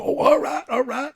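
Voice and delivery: high squeaky mock delight; giddy excitement. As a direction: squeal it up high like a gullible kid. Kid agrees to drive up.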